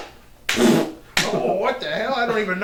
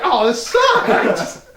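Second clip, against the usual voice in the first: dragged-out laughing groan; very slow hammed-up complaint; slow mock moan; much slower than usual. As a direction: stretch it out in defeated misery. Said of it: "you're just like".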